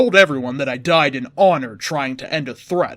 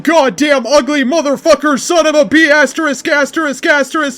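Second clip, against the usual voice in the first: high, loud battle scream at the enemy; furious yell; 10 semitones higher, 7 dB louder than usual. Acted. Hero screaming at Chaos in the final battle, furious yell. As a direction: scream it out with everything you have. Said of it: "I yelled".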